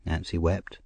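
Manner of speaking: ordinary delivery, nothing marked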